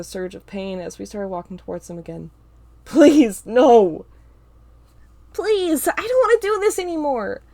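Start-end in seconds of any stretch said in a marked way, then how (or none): 2.8-4.1 desperate scream. A narrator's reading. Begging under attack. 5.3-7.4 high, loud pleading cry; anguished scream. A narrator's reading. Carl screams as he is forced to attack, anguished cry. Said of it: "Carl screams".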